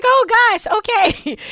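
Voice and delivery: high, loud startled gasp of words; jolted awake and flustered; high loud groggy surprise. Retold or imitated. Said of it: "I was like".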